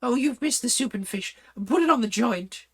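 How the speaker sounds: high, bossy royal voice; Red Queen. Acted. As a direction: play the imperious Red Queen ordering the table. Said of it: "At last the Red Queen began".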